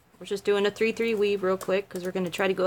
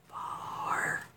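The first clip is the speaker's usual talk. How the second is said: whispered aside; 4 semitones lower, 15 dB quieter, slower than usual. Real, not acted. Self-deprecating phone aside.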